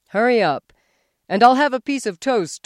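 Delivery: loud, high commanding voice; husband barking breakfast orders; bossy orders. A narrator's reading. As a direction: order her about.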